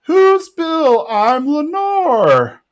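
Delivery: high, drawn-out mock feminine voice; put-on falsetto-like lilt, stretched slow at the end; man posing as a woman. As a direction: imitate a man pretending to be his ex-wife. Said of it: "it's Bill in dress".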